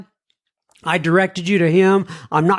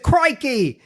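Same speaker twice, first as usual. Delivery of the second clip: mock Aussie crikey; 7 semitones higher than usual. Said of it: "She's an Aussie".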